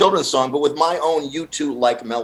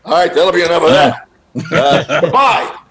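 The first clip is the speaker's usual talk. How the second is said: mock host dismissal; 3 semitones higher, 5 dB louder than usual. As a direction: show them the door in a gruff host voice. Imitating host dismissing them.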